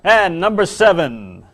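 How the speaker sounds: big comic top-ten reveal; countdown announcer. Acted. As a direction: announce each entry with mock grandeur.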